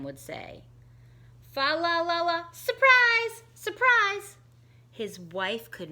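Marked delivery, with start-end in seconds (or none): 1.5-4.4 sung fa la la, then surprise, surprise shouted much higher; excited surprise. Acted. Tim showing his wife the treetop, gleeful and much higher. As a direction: burst in the door with the treetop.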